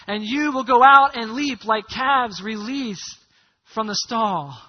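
slow, emphatic Bible reading; preacher's measured, ringing recitation; scripture reading. Acted. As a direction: proclaim the verse, stretching the key words. Reads scripture aloud to congregation.

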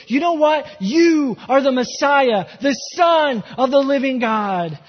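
preacher building passion before the congregation; full-force preaching. Acted. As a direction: preach it with fire.

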